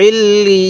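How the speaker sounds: "ইল্লি" with doubled l and a long drawn ee; one-alif stretch. Shown as a demonstration. Teacher showing learners the alif-length pull, drawn-out joining. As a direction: hold the double l in "ইল্লি", then stretch the vowel. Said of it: "সেখানে এক আলিফ টানতে হয়".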